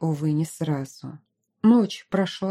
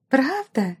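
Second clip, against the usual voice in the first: gasping; 6 semitones higher than usual. Acted. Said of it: "ахнула взволнованно".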